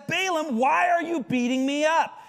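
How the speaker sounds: high, odd little donkey voice; talking donkey. Retold or imitated. The donkey asking Balaam why he beats it, high and plaintive. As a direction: pitch it up like a hurt talking animal.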